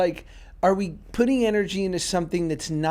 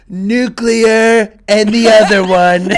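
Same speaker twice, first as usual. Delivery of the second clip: very loud, slow, drawn-out quote; Trump; 4 semitones higher, 13 dB louder, much slower than usual. Retold or imitated. Host quoting a politician's speech, loud and slow. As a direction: blare it out slowly, spacing the punchline. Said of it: "he goes".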